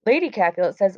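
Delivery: ordinary talk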